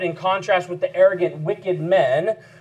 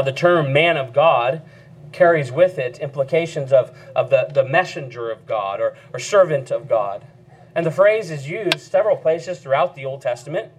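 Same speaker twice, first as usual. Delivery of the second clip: normal talking voice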